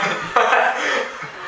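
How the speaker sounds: airless high giggle; breathy high giggle. Real, not acted. Laughs after the answer.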